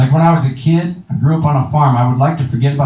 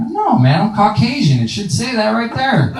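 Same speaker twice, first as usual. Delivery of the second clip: indignant reply; 8 semitones higher than usual. Retold or imitated. Comedian answering cop in story.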